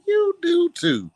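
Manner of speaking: slow, high-pitched retort; teasing comeback. Retold or imitated. Answering insults turned compliments, slow teasing retort. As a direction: stretch it out high as a playful comeback.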